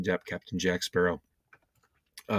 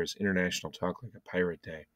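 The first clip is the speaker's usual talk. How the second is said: normal talking voice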